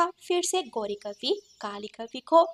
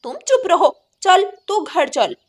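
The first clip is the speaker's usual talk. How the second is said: loud, higher, sneering fury; shouting sister-in-law; 4 semitones higher, 7 dB louder than usual. Acted. Sister-in-law catches Kali talking, shouts her home. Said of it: "ऐसे क्यों चिल्ला रही हो".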